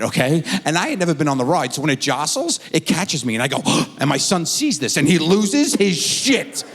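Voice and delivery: ordinary talk, nothing marked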